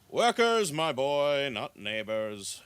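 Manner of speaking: put-on elderly gentleman, opening big, ending measured; old elf gentleman. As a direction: correct the young man like an elder. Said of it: "The old gentleman elf says".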